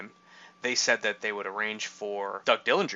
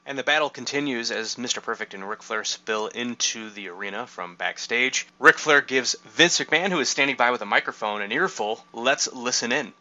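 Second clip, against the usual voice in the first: scripted play-by-play lead-in, building louder; announcer; 5 dB louder than usual. Acted. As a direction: narrate the action like a wrestling announcer. Reads a scripted lead-in to clip.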